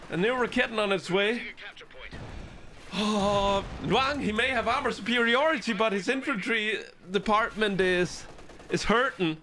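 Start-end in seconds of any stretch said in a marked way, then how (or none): none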